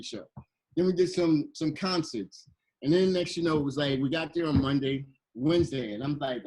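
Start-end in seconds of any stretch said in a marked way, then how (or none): none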